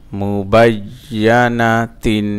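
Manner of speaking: "মুবাইয়ানাতিন" slow and connected in one flow; joined reading pronunciation. Shown as a demonstration. Teacher showing learners the reading form, syllables joined slowly. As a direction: run the syllables of "মুবাইয়ানাতিন" together, measured. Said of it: "reading উচ্চারণ".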